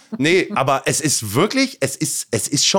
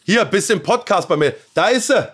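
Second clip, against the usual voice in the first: raised, high voice across a distance; calling out; 10 semitones higher, 5 dB louder than usual. Real, not acted. Son telling his mother she is on the podcast, calling out. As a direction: call over to someone far off.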